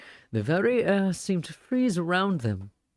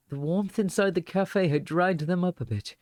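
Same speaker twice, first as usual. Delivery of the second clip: ordinary delivery, nothing marked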